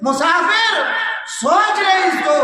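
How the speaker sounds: high, loud, slow cry of the verse; booming pulpit cry on Musafir; preaching shout. Acted. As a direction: call it out to the whole hall. Preacher cries out verse to congregation.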